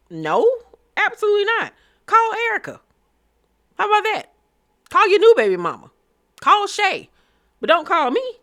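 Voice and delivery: high, loud, fast indignant brush-off; sassy refusal. Retold or imitated. Voicing Bambi's answer to Scrappy, loud and high with sass. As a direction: shut him down, a hammed-up diva no.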